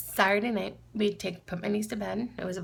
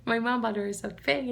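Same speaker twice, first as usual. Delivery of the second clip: ordinary delivery, nothing marked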